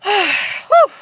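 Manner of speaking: loud exhale, then whew; breathless whew. Real, not acted. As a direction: blow out a tired breath. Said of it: "let me catch my breath".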